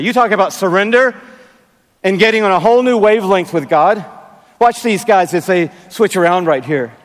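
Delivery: usual speaking voice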